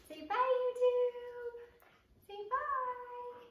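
sing-song. Real, not acted.

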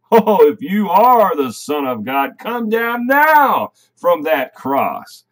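loud mock taunt, rising high and drawn out; imitated jeering shout. Preacher voices the crowd laughing at Jesus on the cross, jeering loudly. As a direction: call it out like a scornful onlooker, stretching the words. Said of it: "screaming, laughing at Jesus".

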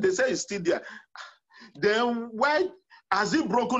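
usual speaking voice

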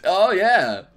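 usual speaking voice

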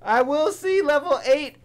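very high, loud whooping joy; real thrilled shouting; ecstatic yelling. Real, not acted. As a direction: cheer like you just got saved. Thrilled at finding monkey bombs.

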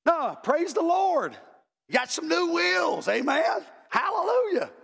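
high-pitched, drawn-out rejoicing; exuberant church praise. Acted. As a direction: whoop it up like a congregant celebrating someone's blessing. Acted rejoicing at another's new car.

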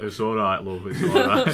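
a hint of old-bloke voice; old man. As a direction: reassure like a kindly old bloke, half laughing. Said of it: "the guy who she did it to".

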